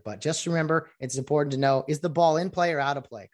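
ordinary talk, nothing marked

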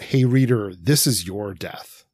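ordinary talk, nothing marked

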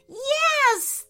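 high, loud cheer of joy; excited cry. Acted. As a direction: cheer yes with delight. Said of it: "the girls cried".

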